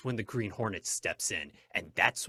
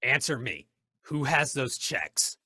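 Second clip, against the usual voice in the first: loud, raised, insistent demand; forceful demand; 4 semitones higher, 6 dB louder than usual. Acted. Masked Hornet interrogates the judge about checks, forceful. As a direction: press hard for an answer, slowing on the question.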